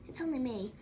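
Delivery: ordinary delivery, nothing marked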